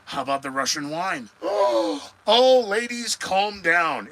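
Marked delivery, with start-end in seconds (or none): 1.3-2.2 mock gasp. Mock shock at Russian wine. 2.3-4.1 mock soothing. Mock calming the women viewers.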